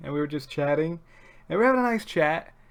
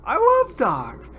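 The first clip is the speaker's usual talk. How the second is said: high, loud, eager mom voice; excited mom who loves dogs; mom's eager voice; 10 semitones higher, 6 dB louder than usual. Retold or imitated. Mom asking to see the dog, high and eager. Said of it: "And my mom goes".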